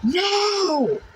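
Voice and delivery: high yelled no; retold scream. Refusing the false prophet in the dream: high yelled no. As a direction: shout back in defiance. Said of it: "I screamed at him".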